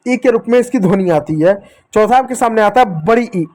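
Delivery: normal talking voice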